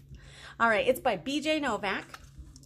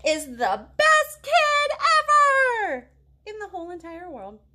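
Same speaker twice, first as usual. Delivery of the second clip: gushing praise; 8 semitones higher, 8 dB louder than usual. Pretend. Praising listening kid.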